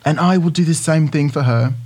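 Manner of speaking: measured British accent. Shown as a demonstration. Co-host reading Idris Elba's promise about his wife, British accent. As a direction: finish the quote in a British accent. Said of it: "Can you read that in your British accent?".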